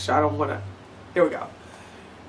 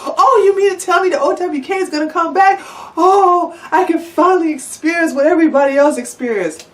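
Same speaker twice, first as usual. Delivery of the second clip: much higher, gushing, eager fan voice; mocking the fans; 11 semitones higher than usual. To her followers, mocking their gushing reaction with a high fan voice. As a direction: ham up a gullible fan. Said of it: "and you all like".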